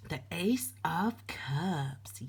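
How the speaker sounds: slow, breathy whispered reveal; softly whispered card name, slow. Real, not acted.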